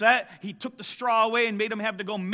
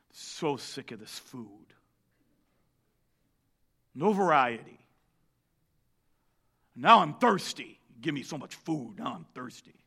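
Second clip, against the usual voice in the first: mock sulky grumble; 7 semitones lower than usual. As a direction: sigh and moan about the food. People complain, sighing.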